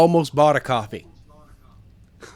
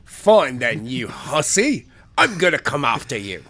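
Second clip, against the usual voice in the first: put-on British English accent. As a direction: threaten a rival in mock British English. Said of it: "in a perfect British English accent".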